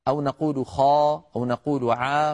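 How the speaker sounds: clearly voiced خا and عا as test sounds; sounding out خا and عا; demo letter sounds. Shown as a demonstration. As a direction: say خا and عا as sample syllables. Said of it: "إذا طلب منا أن ننطق ألف".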